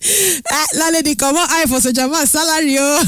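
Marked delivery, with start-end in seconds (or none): none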